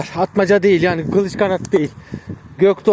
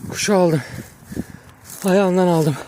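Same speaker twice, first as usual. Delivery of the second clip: breathless, panting between phrases; out of breath after running here; 5 dB quieter than usual. Real, not acted. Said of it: "Nefes nefese kaldım".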